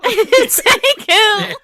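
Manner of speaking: laughing, high, loud and slow. Real, not acted. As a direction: stretch it out through laughter. Echoing the line with a laugh.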